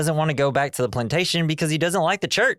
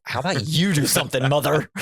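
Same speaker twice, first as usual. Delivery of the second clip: sassy talk-back; 3 semitones higher than usual. Retold or imitated. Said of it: "It's like".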